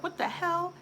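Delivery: high-pitched, baffled retelling; mock-incredulous reaction; acted bewilderment. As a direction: play up the disbelief, pitched high. Acting out her bewilderment at gifts.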